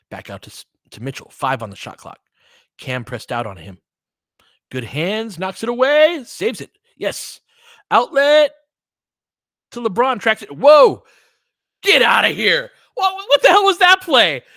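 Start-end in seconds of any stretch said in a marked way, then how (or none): none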